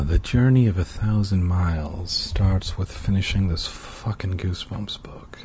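grumbled, fed-up aside, whisper trailing off; weary groan. Real, not acted. Reader grumbling to self about the chore, fed up. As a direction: sigh through it, sick of the book.

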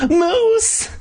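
very high horrified scream; shrieking. A narrator's reading. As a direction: shriek the name in horror. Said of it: "I heard Mindy shriek".

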